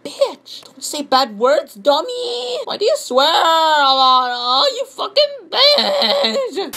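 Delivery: high voice rising into a wail; plead, then build to a hammed-up screech; mock pleading commenter. Commenter begging him not to swear, mock pleading into wailing. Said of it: "you want me to show you?".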